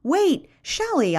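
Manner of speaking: high, drawn-out call; calling after someone. A narrator's reading. Narrator runs after Shelley to thank her, calling out. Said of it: "I say, running after her".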